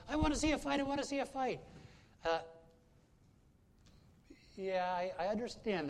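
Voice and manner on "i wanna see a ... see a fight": much higher, loud, eager cry for a fight; excited. Retold or imitated. Woman in the hockey box begging excitedly for a fight. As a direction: plead for a brawl like a thrilled fan, high and loud. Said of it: "she said".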